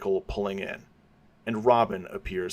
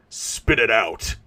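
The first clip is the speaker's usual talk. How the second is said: slow, louder barked order; shout; 5 dB louder, slower than usual. Acted. Batman shouting at the dying gangster, slow and loud. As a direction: force the answer out of him. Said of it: "Batman shouts".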